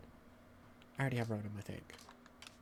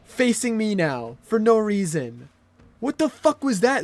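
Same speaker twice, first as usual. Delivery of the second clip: loud frustrated shouting; angry yelling; 5 semitones higher, 7 dB louder than usual. Real, not acted. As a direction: yell at the unfair game. Raging at game.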